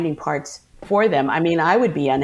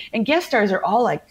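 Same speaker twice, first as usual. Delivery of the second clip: ordinary delivery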